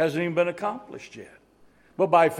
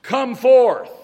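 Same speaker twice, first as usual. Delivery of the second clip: loud, high, general call of come forth; booming unspecific summons; commanding call; 6 semitones higher, 9 dB louder than usual. Retold or imitated. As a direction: call out Come forth to every grave at once. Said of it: "when this one said".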